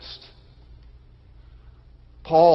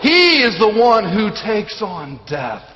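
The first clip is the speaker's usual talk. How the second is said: raised, forceful sermon delivery; emphatic preaching; 4 semitones higher, 4 dB louder, slower than usual. Real, not acted. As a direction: proclaim it with weighty emphasis. Preacher builds to conclusion.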